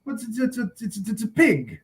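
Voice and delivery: a light impression of the flustered husband; stammering husband. Husband answering his wife, lightly voiced stammer. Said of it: "And he's like".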